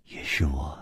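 low, soft, detached admission; a calm confession from the woman; cool and quiet. Acted. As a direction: drop it softly without feeling. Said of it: "淡淡的说".

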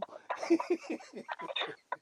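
laugh. Real, not acted. Laughing at listed names.

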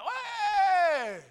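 loud, high, drawn-out "hey"; shouting at dog. Retold or imitated. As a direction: yell to stop a misbehaving dog. Acts out yelling at his dog.

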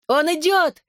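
put-on high-pitched boyish cry of alarm; shouted warning. A narrator's reading. Olli alerts everyone that he is coming, high shout. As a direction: call out a warning, pitch leaping up. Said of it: "крикнул Олли".

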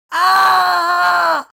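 high, loud scream of pain; agonized drawn-out scream; long howl. A narrator's reading. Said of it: "üvöltött egyfolytában".

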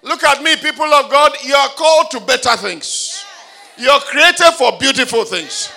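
loud, high declaration over the crowd; fervent shouted decree; full-force preaching. Acted. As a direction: preach it with rising power.

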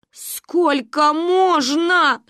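slow, high, stretched-out wail; lamenting. A narrator's reading. Mother at her wits' end, lamenting. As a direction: draw out each syllable in exasperation. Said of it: "причитала мама".

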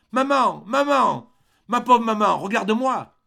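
high, loud desperate cry to his mother. Acted. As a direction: call out to her in anguish, loud and high. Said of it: "il cria". (cri désespéré, aigu et fort)

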